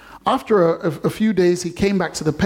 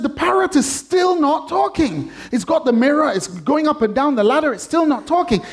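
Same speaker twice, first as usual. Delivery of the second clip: much higher, frustrated complaint; exasperated customer; 9 semitones higher than usual. Retold or imitated. Customer back at the pet store again, high and exasperated. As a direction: whine it up high, fed up. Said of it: "He said".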